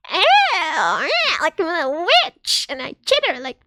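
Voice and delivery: high witchy chittering bug voice; chittering witch cockroach. Acted. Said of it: "that's why I was like".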